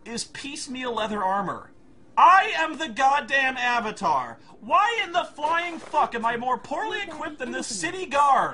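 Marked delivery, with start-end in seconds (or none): 2.2-8.5 indignant outrage. Real, not acted. Reviewer outraged at weak gear.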